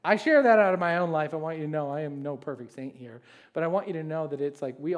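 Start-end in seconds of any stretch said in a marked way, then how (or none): none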